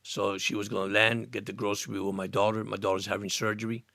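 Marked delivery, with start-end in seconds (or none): none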